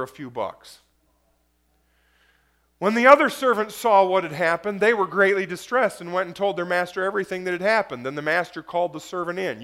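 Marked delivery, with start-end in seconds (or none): 2.8-9.6 brisk, pushing sermon delivery; fast preaching. Acted. Pastor pushes on through the parable to the congregation, preaching fast. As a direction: drive the story forward.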